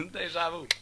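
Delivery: high Brooklyn echo; Brooklyn accent. Pretend.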